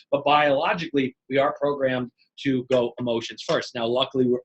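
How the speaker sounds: ordinary delivery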